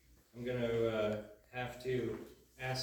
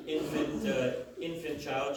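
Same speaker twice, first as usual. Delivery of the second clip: ordinary delivery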